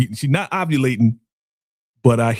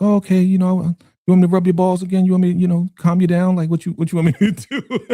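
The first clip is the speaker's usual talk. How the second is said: lightly voiced coaxing woman, pitched up; 6 semitones higher than usual. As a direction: imitate her offering comfort in a higher voice. Voicing her coaxing him to calm down.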